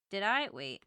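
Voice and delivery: ordinary talk, nothing marked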